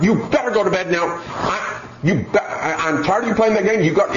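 acted exasperated scolding; irritated mother. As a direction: play a mom losing patience. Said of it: "she's gotten more and more irritated".

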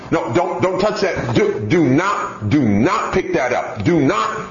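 mock a mother's toothless warnings; scolding mom. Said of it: "she was telling her".

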